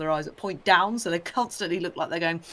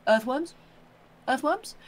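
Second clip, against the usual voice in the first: lightly voiced robin, rising higher and higher; a nosy little bird hoping for worms, asked higher each time; eager robin; 5 semitones higher than usual. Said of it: "they're like".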